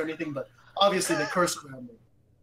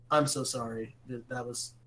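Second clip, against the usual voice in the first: soft, low apology trailing quieter; hushed, lowered "sorry" voice; retold contrite apology; 4 semitones lower, 7 dB quieter than usual. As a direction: apologize gently, dropping your volume. Retold apology to crying woman.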